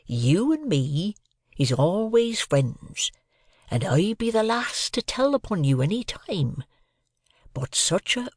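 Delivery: slow, confiding Joe; slow confidential Joe. A narrator's reading. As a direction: confide in the boy alone. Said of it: "speaking in a confidential voice".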